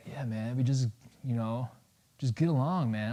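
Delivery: soft, laid-back hippie imitation; quiet, easygoing mock-hippie; mellow peacenik. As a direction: mimic a mellow pacifist, very softly. Mimics a peace-loving anti-war type.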